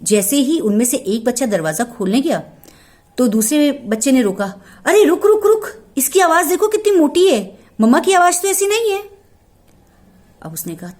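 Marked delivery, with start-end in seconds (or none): 4.8-9.0 high, loud, rushed alarm; one kid urgently stops the other from opening; alarmed warning. Acted. (घबराकर ज़ोर से रोकता बच्चा)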